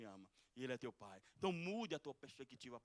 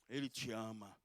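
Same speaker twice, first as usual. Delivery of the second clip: normal talking voice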